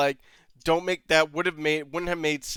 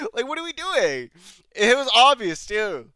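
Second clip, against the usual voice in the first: normal talking voice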